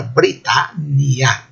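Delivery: Britânia slowly split, bri-TAN-ni-a; "Britânia" syllable by syllable, no nasal a; double n pronounced. Shown as a demonstration. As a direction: say "Britânia" with open a and held double n. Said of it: "os dois N's seriam os dois pronunciados".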